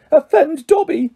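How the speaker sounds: high, choked-up elf. A narrator's reading. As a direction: squeak it through rising tears. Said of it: "choked the elf".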